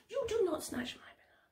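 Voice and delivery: soft, quick, whispered telling-off; scolding under the breath; hushed warning. Acted. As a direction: whisper a sneaky little warning. Blue monkey warns red monkey.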